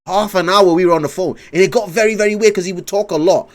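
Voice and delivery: usual speaking voice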